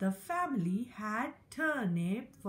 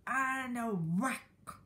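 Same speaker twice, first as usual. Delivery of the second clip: usual speaking voice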